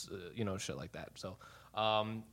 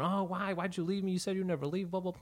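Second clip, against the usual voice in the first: high-pitched imitation of tearful pleading; mock crying; 8 semitones higher than usual. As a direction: imitate a wailing plea over the phone. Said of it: "crying on the phone".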